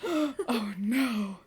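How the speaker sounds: gasping mock dread; hammed-up caught-out gasp. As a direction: gasp like you've been caught. Caught when he comes home.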